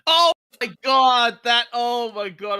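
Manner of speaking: loud, slow exasperation; exasperated. Real, not acted. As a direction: groan it out, fed up. Exasperated at argument.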